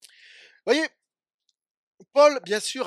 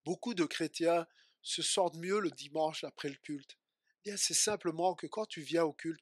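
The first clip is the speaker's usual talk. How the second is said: ordinary delivery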